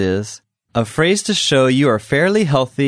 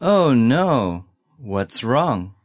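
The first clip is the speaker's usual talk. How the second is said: slow, careful concerned question; slowly; much slower than usual. Shown as a demonstration. Reacting to Vicky's news in practice dialogue, slowly. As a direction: take it at a learner's pace. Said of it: "one more time slowly".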